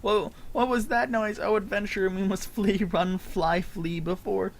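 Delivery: high, eager little goblin voice; voiced NPC.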